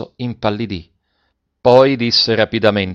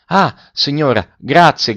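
raised voice, pitched up a touch; boy crying thanks to the shopkeeper, raised voice; eager cry; 4 semitones higher, 5 dB louder than usual. A narrator's reading. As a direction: call out the thanks, boy overjoyed. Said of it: "gridò Marco".

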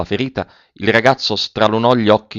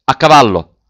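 loud, raised cavalry order; shouted command; 4 semitones higher, 6 dB louder than usual. A narrator's reading. Officer to his soldiers, shouting the order. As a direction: call the troop to mount. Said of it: "e gridò".

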